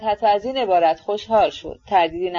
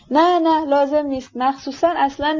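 eager protest; 6 semitones higher than usual. A narrator's reading. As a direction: cut them off eagerly. Aglaya protests hastily. (اعتراض پرشتاب با صدای بلندتر)

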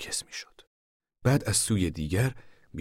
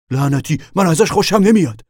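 higher, louder uneasy man's voice; a nervous man cursing, pitched up; 12 semitones higher, 14 dB louder than usual. A narrator's reading. The man at the tent flap cursing his unease, higher and louder.

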